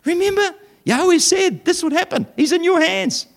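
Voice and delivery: high, excited egging-on voice; eager urging mates. Acted. David's men urge him to kill the sleeping king, high and eager.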